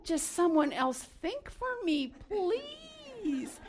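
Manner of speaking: high, drawn-out mock plea; exaggerated pleading. Overloaded parent begging someone to think for her, exaggerated pleading. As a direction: beg slowly, stretching the last word way up high.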